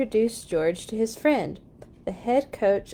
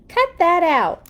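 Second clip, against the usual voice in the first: higher, louder shout of a camera woman; 8 semitones higher, 6 dB louder than usual. A narrator's reading. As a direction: call out sharply across the crowd. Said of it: "shouted the camera woman".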